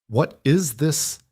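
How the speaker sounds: high, slow, demanding question. A narrator's reading. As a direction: press him hard for an answer, slowly. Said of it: "Theo demanded".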